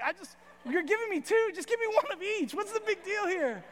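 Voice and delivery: high-pitched mock pleading; exasperated, incredulous whine of a retold complaint; bewildered protest. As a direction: protest high in the voice, acting baffled. Acting out Jake's shocked protest.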